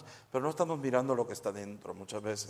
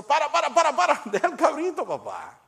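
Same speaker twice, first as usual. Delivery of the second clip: much higher, much louder frantic stop; comic shout; 13 semitones higher, 8 dB louder than usual. Acted. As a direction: yell to stop him, half laughing. Priest stops Juanito with goat.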